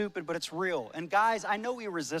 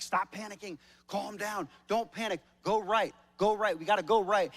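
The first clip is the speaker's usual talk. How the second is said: raised, higher, insistent orders; higher, louder urgent instructions from the back of the canoe; urgent commands; 5 semitones higher, 5 dB louder than usual. Retold or imitated. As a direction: bark calm-down orders at a panicking partner. Calming panicking wife in canoe.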